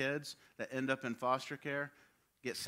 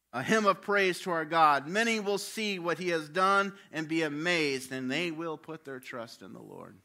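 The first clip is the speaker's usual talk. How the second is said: scripture reading; 5 semitones higher, 8 dB louder than usual. Acted. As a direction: read the psalm with feeling.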